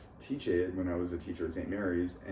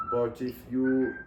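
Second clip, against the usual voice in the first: slow, careful attempt at a Hindi accent; demonstrated Indian English accent, drawn out; 3 semitones higher, 3 dB louder, slower than usual. Shown as a demonstration. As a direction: speak English like a Hindi speaker, very measured. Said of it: "you might sound kind of Hindi".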